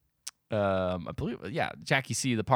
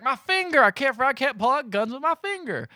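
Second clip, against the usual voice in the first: mock pained wail; 12 semitones higher than usual. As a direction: wail it like a hammed-up injured man. Retelling man with hurt finger.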